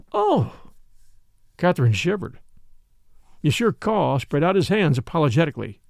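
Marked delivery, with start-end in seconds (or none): 0.1-0.7 sudden high startled oh; shocked gasp. A narrator's reading. Catherine hearing the woman was strangled, startled gasp. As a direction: recoil at the grim news.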